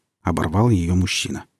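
plain reading voice

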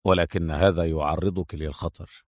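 low, soft, curt male voice; deep, unemotional officer; dry tone. A narrator's reading. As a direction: drop low and warn drily. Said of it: "قال أدهم بلهجة جافة".

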